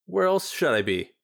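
a grieving woman putting on a brave face; grieving friend. Acted. Ariana voiced in lore reading.

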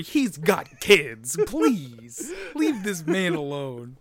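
slow, drawn-out plea with loud emphasis; mock pleading. Defending a hapless wrestler from his bullies, mock pleading. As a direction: beg in mock outrage, stretching the words.

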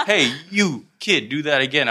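hooked and half-laughing, beg for a repeat; amazed call out. Retold or imitated. Older guys calling to the kid who dunked, amazed and laughing.

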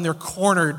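usual speaking voice

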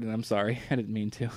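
sleepy low mumble through a yawn; apologizing mid-yawn. Real, not acted. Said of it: "yawning while I'm talking".

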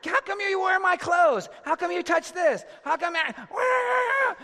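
very high, loud mock yelling; squabbling kids. Kids squabbling over clothes and belongings, imitated in high yells. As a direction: screech it out, imitating kids in a fight. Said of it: "if my kids are going".

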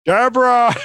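loud, high open-mouthed yell of "Deborah". Shown as a demonstration. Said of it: "Can you say Deborah like that?".

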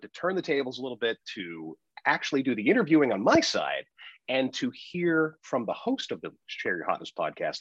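usual speaking voice

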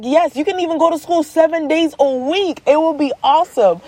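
put-on high, peppy hype for the kids; acted parental enthusiasm; mock-excited parent. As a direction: sell it brightly in a high voice.

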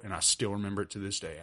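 normal talking voice

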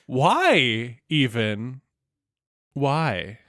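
very slow, puzzled imagined singer. Pretend. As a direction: drag it out, baffled. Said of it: "she's like".

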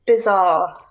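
ordinary delivery

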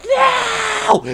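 very high, loud yell; panicked shout. Retold or imitated. Friends below screaming at the climber, panicked shout. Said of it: "They're like".

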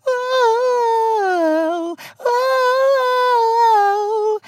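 long, high sung falsetto wail; playful falsetto howl; falsetto "ooh". Pretend. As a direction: hold a very high, softening "ooh". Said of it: "I was gonna say falsetto".

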